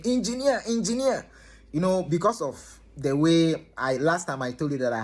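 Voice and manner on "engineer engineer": high, louder call of engineer through the door; neighbor's high urgent call through the door; calling out name. Retold or imitated. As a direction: call out for him from outside.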